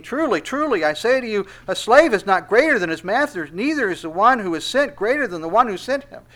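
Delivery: high, loud pulpit preaching; full-force sermon delivery. Acted. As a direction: proclaim it to the whole church.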